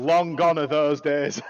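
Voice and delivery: loud, slow, laughing through it. Real, not acted. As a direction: laugh the words out. Joking about GM's old ways.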